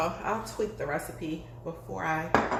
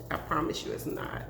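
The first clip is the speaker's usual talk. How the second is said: ordinary talk, nothing marked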